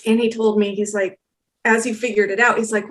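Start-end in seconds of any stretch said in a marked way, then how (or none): none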